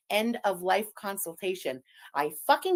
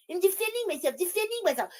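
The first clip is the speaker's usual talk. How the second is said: higher, whiny parody of her excuse; mocking imitation; 8 semitones higher, faster than usual. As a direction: mimic her like a broken record. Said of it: "you see, right away".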